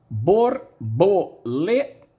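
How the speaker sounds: "Borboleta." drawn out slowly in four pieces; slow sounding out; slow syllables. Shown as a demonstration. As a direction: spell out "Borboleta." beat by beat. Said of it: "tem quatro pedacinhos".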